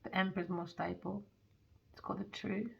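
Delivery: hushed, close comforting read; soft intimate reading. Real, not acted. Tarot reader soothing the viewer, hushed. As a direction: speak softly to the listener.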